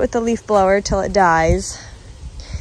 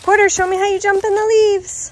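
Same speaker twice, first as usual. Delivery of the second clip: calling out; 12 semitones higher, 5 dB louder than usual. Real, not acted. Mom calling to son outdoors.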